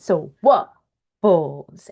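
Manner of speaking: modelling word stress on waffles slowly; stress on WAF. Shown as a demonstration. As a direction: stretch out waffles, leaning on the first syllable. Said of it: "which one is the loud sound?".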